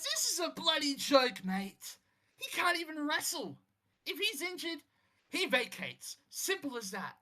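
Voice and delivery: mildly hammed-up furious rant; lightly voiced angry rival, biting; furious challenger. Rival wrestler objecting to the champion keeping his title, mock fury. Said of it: "a furious Will Ospreay".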